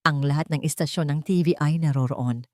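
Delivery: ordinary delivery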